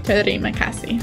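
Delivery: ordinary delivery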